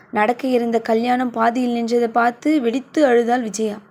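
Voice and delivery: ordinary delivery, nothing marked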